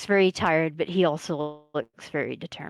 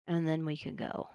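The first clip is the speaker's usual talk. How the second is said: very quiet; 9 dB quieter than usual. Acted. Tired man agreeing to go after a sigh, very quietly. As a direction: say it very quietly, much softer. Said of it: "and very quietly says".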